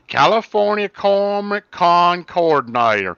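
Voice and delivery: a hammed-up Southern gentleman accent. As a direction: read it in a thick Southern accent for her. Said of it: "I will read it in a Southern voice just for her".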